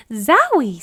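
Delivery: loud surprised outburst; startled exclamation. Acted. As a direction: blurt it as the stench hits. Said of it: "said Ada".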